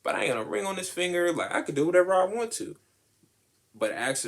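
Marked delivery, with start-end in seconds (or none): 0.0-2.8 high, fast taunting brag; mock sassy boast; sassy defiance. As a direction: flaunt the bare finger, quick and high. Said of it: "so it's like".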